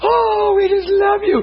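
very high-pitched adoring imitation; mock gushing. Preacher imitating women in a prayer circle, mock gushing. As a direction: gush like an overcome worshipper.

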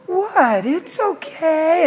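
high, slow; tipsy girl voice. Retold or imitated. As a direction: coo it up high like a tipsy woman. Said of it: "She goes".

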